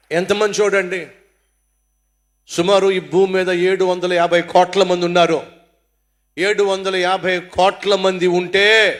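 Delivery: loud, driving sermon voice; full-force preaching. Real, not acted. Preacher driving home his point to the congregation, loud. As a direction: preach it out to the whole hall.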